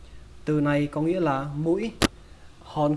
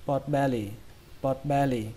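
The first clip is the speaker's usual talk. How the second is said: "pot belly" run together as one quick word; quick reading. Shown as a demonstration. Teacher shows learners the fast way, quick joined-up "pot belly". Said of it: "Cách đọc nhanh".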